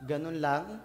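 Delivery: ordinary talk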